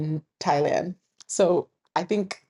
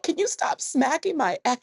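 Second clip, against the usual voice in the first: indignant protest; 11 semitones higher than usual. Retold or imitated. Retold protest at market woman.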